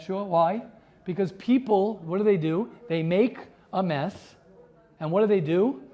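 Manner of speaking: ordinary delivery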